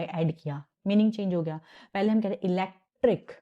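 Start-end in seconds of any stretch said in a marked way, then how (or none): 2.5-3.3 electric with the stress on elect; stress on LECT. Shown as a demonstration. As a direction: punch the elect part of electric. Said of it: "तो elect पे हमारा".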